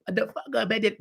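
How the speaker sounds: quick, muffled mock cursing; imitated behind-the-scenes talk. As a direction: imitate officials cursing at each other. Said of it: "they talk like this".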